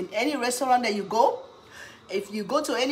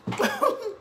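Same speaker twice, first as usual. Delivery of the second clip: high laugh. Real, not acted. Laughs at a question.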